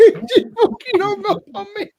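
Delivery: laughing. Real, not acted. Laughing about a friend hanging up.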